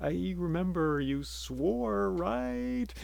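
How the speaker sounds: nervous, slow question rising high at the end; timid reminder to the giant; a touch of fear; a little scared. A narrator's reading. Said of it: "the fisherman was a little scared".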